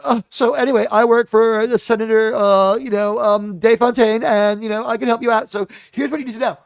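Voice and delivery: nervous, high-pitched blurting assistant; Mel. Acted. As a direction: gush out the exposition in a flustered ramble. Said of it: "everyone's just always nervously blurting out their backstory".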